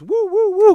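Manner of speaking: high, loud wailing siren; mock ambulance siren wail; siren imitation. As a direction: whoop it out like an ambulance.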